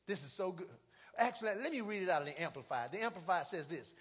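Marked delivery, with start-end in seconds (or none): none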